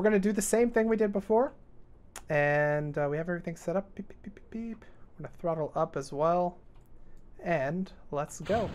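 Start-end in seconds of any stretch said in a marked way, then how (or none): 3.9-4.8 tiny, very soft electronic beeps; imitated beeping. Real, not acted. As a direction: peep out quick little machine beeps. Imitates beeps while setting up.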